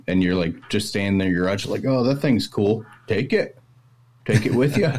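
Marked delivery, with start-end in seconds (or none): 1.9-5.0 put-on a light Maine accent, a bit higher; easygoing Maine old-timer offering a gift; a touch of a Maine accent; old-timey Mainer. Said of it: "you go over to any old-timey Mainer's house".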